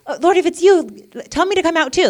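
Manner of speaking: high, loud, eager outburst; bold excited Peter. Acted. Peter asking Jesus to join him, excited outburst. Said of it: "Peter was like".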